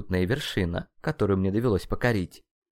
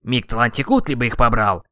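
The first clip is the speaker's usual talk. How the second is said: loud angry curse; forceful burst of outrage; indignant; 2 semitones higher, 8 dB louder than usual. A narrator's reading. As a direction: curse them out loudly. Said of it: "возмутился ацтек".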